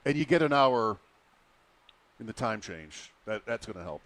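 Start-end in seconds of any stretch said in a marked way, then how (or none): none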